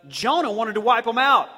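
ordinary delivery

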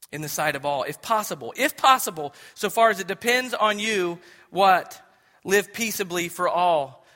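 solemn public reading; scripture reading. Real, not acted. Reads scripture aloud.

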